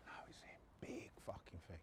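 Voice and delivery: whispered aside of dread; hushed, voiceless retold groan of dismay. Muttered dread on seeing him.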